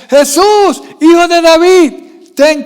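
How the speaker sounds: high, loud acted shout; imitated beggar crying out; Bartimeo's cry. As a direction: call out to be heard over a crowd. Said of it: "¿Qué gritaba Bartimeo?".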